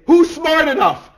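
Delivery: high, loud, slow scoffing question; mocking exclaim. Shown as a demonstration. Preacher contrasting the wrong question, loud and mocking. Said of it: "It doesn't say".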